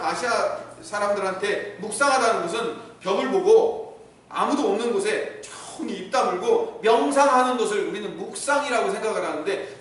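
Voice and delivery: ordinary talk, nothing marked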